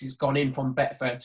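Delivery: ordinary delivery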